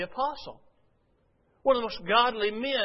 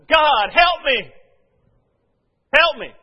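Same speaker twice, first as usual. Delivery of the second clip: God, help me yelled much higher and louder, slow; yelling; 8 semitones higher, 15 dB louder, slower than usual. Retold or imitated. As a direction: yell it up to the sky in desperation. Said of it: "I yelled it out loud".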